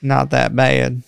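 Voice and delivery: slow, low Southern drawl; convincing put-on Southern accent; fake Southern accent. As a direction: mimic it back in a Southern twang. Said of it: "sounding a little bit too genuine".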